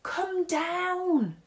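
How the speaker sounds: high loud call from below; shouting. A narrator's reading. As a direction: yell it up to the windows. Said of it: "shouted Zoe at the very top of her voice".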